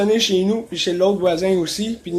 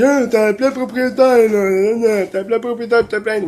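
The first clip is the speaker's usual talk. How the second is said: mocking the neighbour; 3 semitones higher, 3 dB louder than usual. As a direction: throw it back at him in a mocking voice. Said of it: "parce qu'il dit". (imitation moqueuse du voisin)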